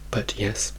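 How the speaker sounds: normal talking voice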